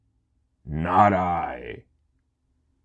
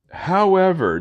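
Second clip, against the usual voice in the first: dramatic emphasis; 14 semitones higher, 6 dB louder than usual. Acted. Repeated higher, dramatic.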